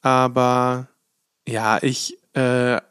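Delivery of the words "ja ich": ordinary talk, nothing marked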